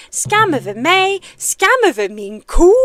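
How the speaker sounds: loud theatrical curse in Swedish; Mushu in Swedish. Acted. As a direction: proclaim the shame like a dramatic dragon. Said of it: "Mushu's monologue from Disney, but in Swedish".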